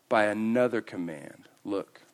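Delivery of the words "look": ordinary talk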